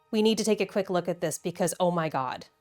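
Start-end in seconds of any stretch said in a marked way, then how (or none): none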